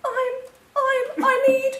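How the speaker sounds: high, halting, lump in the throat; choked up. A narrator's reading. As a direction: struggle to get the words out. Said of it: "making it very difficult to talk".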